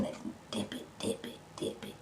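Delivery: whispered playful chant. Real, not acted. Eater dipping food at the mic, whispered.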